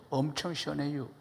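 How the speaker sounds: brief Chungcheong punchline; Chungcheong dialect. Shown as a demonstration. Preacher comparing dialects for the congregation, Chungcheong version. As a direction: answer like a Chungcheong local. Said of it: "충청도".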